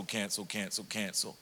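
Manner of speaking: resigned fading echo; fading repetition. Retold or imitated. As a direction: let each cancel fade away. Plans cancelled one by one.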